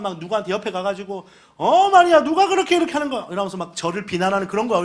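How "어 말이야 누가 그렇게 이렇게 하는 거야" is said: higher, louder, fast grumble; a busybody griping behind backs; gossiping complainer. Retold or imitated. Churchgoer griping about the pastor to a friend, fast and higher.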